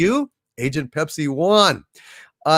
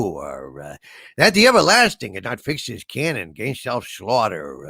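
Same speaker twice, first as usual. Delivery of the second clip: Columbo voice; 7 semitones lower than usual. Acted. Streamer does Shakespeare in a Columbo voice on a viewer's request, lightly voiced. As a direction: recite Hamlet as a rumpled TV detective, only a hint of it. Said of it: "do some Shakespeare in the Columbo voice".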